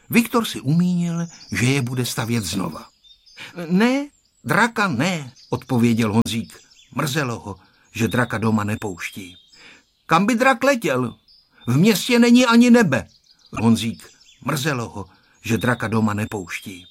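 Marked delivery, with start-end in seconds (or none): 3.7-5.3 put-on boy's refusal. A narrator's reading. Boy answers no in story. 10.1-13.1 put-on high, loud scornful child; contemptuous kid, pitched way up and growing louder. A narrator's reading. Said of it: "řekl pohrdlivě".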